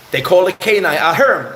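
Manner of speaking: ordinary talk, nothing marked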